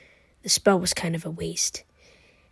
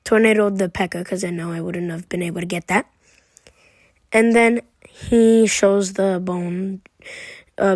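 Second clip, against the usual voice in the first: ordinary delivery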